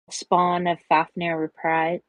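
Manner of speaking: usual speaking voice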